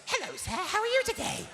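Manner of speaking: high, prim and proper voice; prim 1940s housewife. Retold or imitated. Pupil greeting her teacher in a prim housewife voice. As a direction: play the well-mannered little girl. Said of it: "like a repressed nineteen forties housewife".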